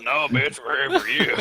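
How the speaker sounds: cheesy porno voice. Retold or imitated. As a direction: deliver it like a bad porn actor. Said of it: "I was gonna make a porno joke".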